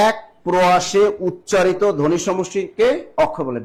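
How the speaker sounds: slow, measured teacher's definition; careful emphatic definition. Shown as a demonstration. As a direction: spell it out slowly, phrase by phrase, so they remember. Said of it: "ভালো করে খেয়াল রাখেন".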